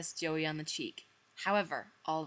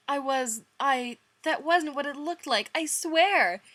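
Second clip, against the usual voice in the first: rising high panicky denial; panicked; 8 semitones higher, 6 dB louder than usual. Acted. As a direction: blurt it out in a panic.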